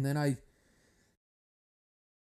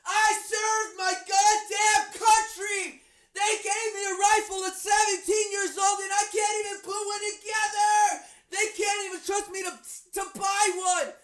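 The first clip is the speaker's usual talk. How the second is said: ranting. Retold or imitated. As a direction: vent the outrage at full volume. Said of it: "I'm like".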